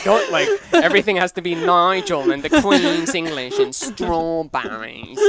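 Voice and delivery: loud, high hammed-up British; Austin Powers. Host mocking own British attempt, loud Austin Powers accent. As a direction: overdo the Queen's English, laughing. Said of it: "I sound like Austin Powers".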